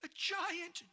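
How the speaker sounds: put-on a boy yelling in wonder; shout. George seeing the giant's hand, excited shout. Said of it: "George shouted".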